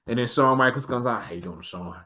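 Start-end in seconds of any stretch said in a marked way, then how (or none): 1.2-1.9 low, hushed casual hello; soft low passing greeting; low quiet greeting. Retold or imitated. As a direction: nod past them murmuring.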